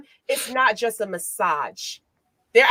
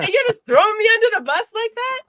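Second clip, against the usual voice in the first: high, loud hurt indignation; high-pitched wounded complaint; mock betrayed; 12 semitones higher, 6 dB louder than usual. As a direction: throw the accusation up high. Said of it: "You're like".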